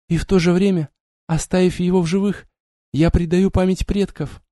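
put-on young woman voice. Vampiress confesses her dilemma.

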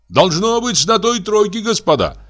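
high-pitched shout to another carriage; calling out. A narrator's reading. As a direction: call over to the gentlemen. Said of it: "крикнул Егор Егорыч". (высокий окрик с повозки)

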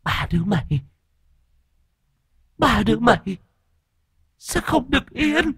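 put-on high eerie possessed child voice; shrill shriek. A narrator's reading. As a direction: hiss the curse in a shrill, ghostly voice. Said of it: "thằng Sình rít lên the thé".

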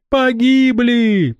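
very high, slow wail of despair; terrified cry. A narrator's reading. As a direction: cry out all is lost. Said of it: "вскричала Ванда". (отчаянный вопль ужаса)

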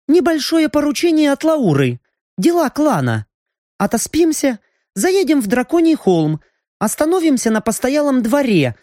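a lightly voiced sorceress; female mage voice. Raina the mage speaks. (голос магички)

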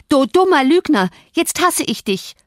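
put-on high, furious girl's outburst; acted red-faced fury; panting with rage. A narrator's reading. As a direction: spit out the insult in a high, angry voice. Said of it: "keuchte sie rot vor Zorn".

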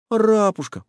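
high, coaxing plea; begging. Acted. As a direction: coax him with a pet name. Boy begging for chocolate.

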